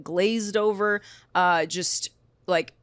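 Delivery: ordinary talk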